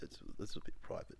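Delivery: unvoiced whisper. Real, not acted. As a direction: whisper it sheepishly. Embarrassed confession.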